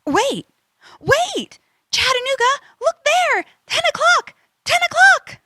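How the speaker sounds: excited spotting. Acted. Copilot spots something ahead, urgent rising calls.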